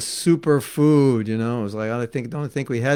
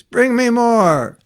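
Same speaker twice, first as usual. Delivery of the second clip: slow, louder, higher, eager; loud request; 9 semitones higher, 7 dB louder, much slower than usual. Retold or imitated. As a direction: demand more, slowly and gladly. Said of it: "Prabhupada eats".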